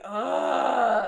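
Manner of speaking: loud, exasperated guttural "ugh"; guttural groan. Acted. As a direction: let out a loud groan from the throat. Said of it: "lets out a guttural".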